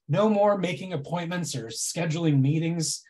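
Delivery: ordinary delivery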